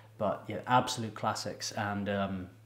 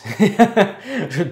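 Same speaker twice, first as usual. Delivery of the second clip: bursts out laughing; a big real laugh; loud laugh; 9 semitones higher, 14 dB louder than usual. Real, not acted. Big laugh at own joke.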